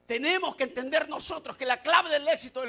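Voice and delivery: loud preacher's proclamation, pitch lifted; full-force preaching. Real, not acted. Preacher gives congregation the key to success, full-force preaching. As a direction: drive the key lesson home to the congregation.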